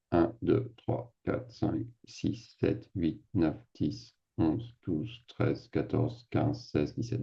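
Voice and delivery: soft, low count to yourself; hushed private tally; counting under breath. Real, not acted. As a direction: count the items off quietly, half-whispered. Counting list items to himself.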